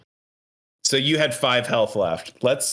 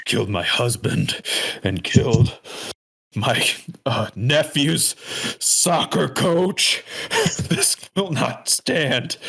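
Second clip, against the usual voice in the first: aggrieved avenger; 3 semitones higher than usual. Acted. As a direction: declare vengeance with hammed-up gravity.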